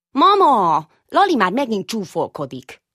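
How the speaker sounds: put-on high, loud complaining little boy; whiny little child. Little Ödönke calls to his mother, high loud whine.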